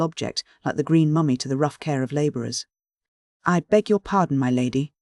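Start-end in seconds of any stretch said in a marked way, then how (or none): none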